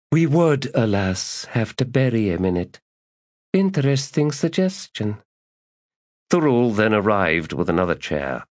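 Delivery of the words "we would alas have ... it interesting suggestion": high, dry and deadpan; dry priestess. Acted. Priestess answering the lady, high and dry. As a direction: answer with cold, dry wit.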